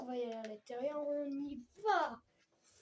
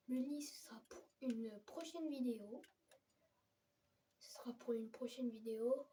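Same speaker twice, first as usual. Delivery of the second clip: quiet whispered aside; 3 dB quieter than usual. Real, not acted. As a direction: whisper the teaser. Chuchote l'annonce. (aparté chuchoté)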